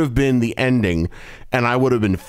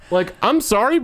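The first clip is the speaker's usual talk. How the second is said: usual speaking voice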